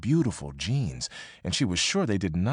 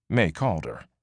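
ordinary delivery, nothing marked

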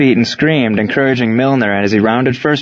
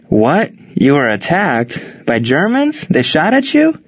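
incredulous questions climbing much higher; alarmed disbelief; 6 semitones higher, slower than usual. Acted. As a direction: blurt it out in rising shock. Batter alarmed at news of attack.

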